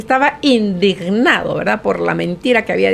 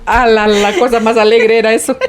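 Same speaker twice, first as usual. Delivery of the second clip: laughing. Real, not acted. Laughs recalling teen escapades.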